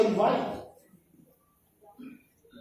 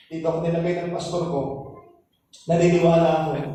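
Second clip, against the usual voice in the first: ordinary talk, nothing marked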